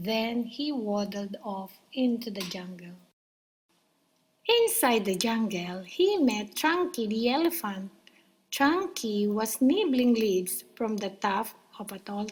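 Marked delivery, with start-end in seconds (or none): none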